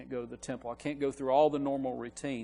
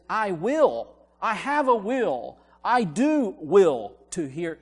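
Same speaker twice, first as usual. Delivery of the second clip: slow, emphatic "will", raised above the other words; stress on "will"; 6 semitones higher, 8 dB louder, slower than usual. Shown as a demonstration. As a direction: punch "will" loud and drawn out. Contrasting readings to stress willingness.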